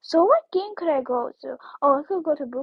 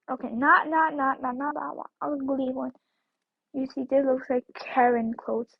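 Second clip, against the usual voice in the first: ordinary talk, nothing marked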